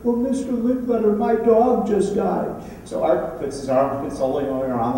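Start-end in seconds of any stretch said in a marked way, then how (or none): 0.0-2.6 put-on higher, slow little boy's voice. Little boy tells Art his dog died, higher and slow. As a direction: lightly voice a sad six-year-old. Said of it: "And the little boy says".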